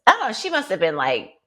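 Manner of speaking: ordinary delivery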